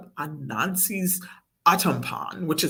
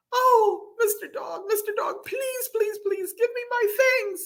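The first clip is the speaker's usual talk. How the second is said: put-on high-pitched little girl pleading; begging. A narrator's reading. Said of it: "Salma begged".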